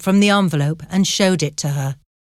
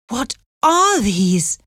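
slow, higher-pitched dismay; horrified exclamation; 5 semitones higher, slower than usual. A narrator's reading. Anne is handed gold nuggets by her brother, horrified. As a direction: exclaim it slowly, in acted horror. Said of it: "exclaimed Anne in horror".

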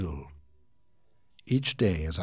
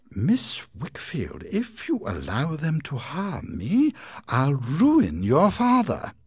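high, slow, louder menacing threat; 8 semitones higher, 6 dB louder, slower than usual. Acted. Heep threatening Agnes about her father, slow and menacing.

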